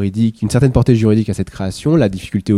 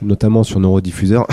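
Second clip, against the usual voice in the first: ordinary delivery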